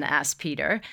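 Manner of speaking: plain reading voice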